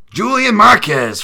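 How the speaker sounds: loud, high name call; hammed-up announcer. Calling the fighter's name, loud and high. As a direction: belt the name like a ring announcer.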